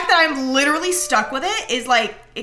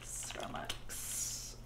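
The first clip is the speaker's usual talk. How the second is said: hushed whisper; 25 dB quieter, much slower than usual. Real, not acted. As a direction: whisper it under your breath. Whispered aside.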